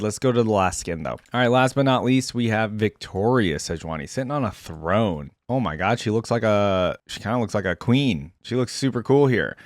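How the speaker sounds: ordinary talk, nothing marked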